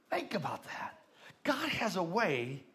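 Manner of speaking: hushed whisper to the church. Real, not acted. Pastor urging the church to reflect, in a whisper. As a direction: lean in and breathe it.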